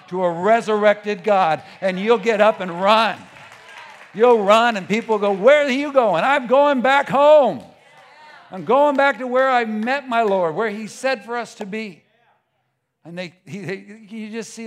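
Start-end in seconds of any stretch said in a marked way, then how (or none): 0.1-12.0 full-voiced preacher's shout, pitch climbing; fired-up preaching. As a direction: shout it out jubilantly, heading home. Preacher builds to running home.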